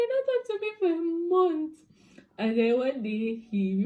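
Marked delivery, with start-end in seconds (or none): 0.1-1.7 incredulous exclamation. Real, not acted. Storyteller revealing his month of silence, high incredulous wail. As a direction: exclaim it high, stretching the last word.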